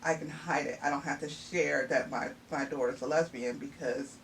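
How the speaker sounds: ordinary delivery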